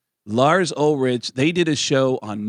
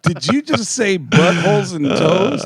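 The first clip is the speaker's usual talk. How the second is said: incredulous; 6 semitones higher, 4 dB louder, slower than usual. Retold or imitated. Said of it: "I went".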